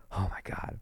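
hushed, voiceless aside; under-the-breath disbelief. Retold or imitated. To himself after the cop lets him off, whispered disbelief. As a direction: whisper it to yourself.

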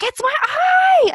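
high, drawn-out frustrated yell; retold shout. Friend imitating her hurt friend's outburst, shouted. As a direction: yell it out, stretching the words. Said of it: "like, shouted at that guy".

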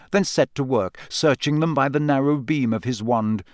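ordinary delivery

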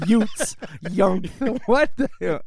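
high, loud laughing repeat of youngth; laughing at mistake. Real, not acted. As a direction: giggle over the word youngth. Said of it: "youngth in my youth".

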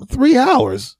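shocked high voice, indignant at the wait; indignant disbelief. Retold or imitated. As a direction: squeak it out in outrage. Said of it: "I'm going".

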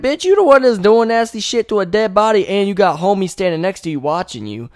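high, loud telling-off that cools down; loud high rant fading back to normal; mock angry comeback. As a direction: chew him out, then settle. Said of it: "You're like".

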